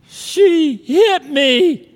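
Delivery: put-on high, slow, loud wailing child; tearful three-year-old tattling; crying little boy. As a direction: whine it like a hurt toddler. Sobbing child voiced in story.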